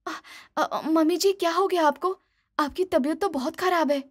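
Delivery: a touch of fright; panicked daughter-in-law. Acted. Daughter-in-law seeing sick mother-in-law: high, stammering.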